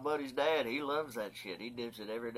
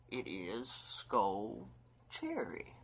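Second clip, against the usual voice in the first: dramatic reveal; much slower than usual. Acted.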